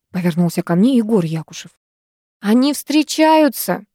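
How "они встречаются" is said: high, drawn-out interjection; raised-pitch interruption; cutting in. A narrator's reading. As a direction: butt in with an accusation. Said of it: "перебила его Вита".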